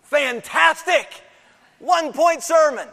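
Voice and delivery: high, loud mock delight at a short sermon; mock cheer. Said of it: "Some of you are going".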